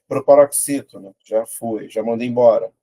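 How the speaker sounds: ordinary delivery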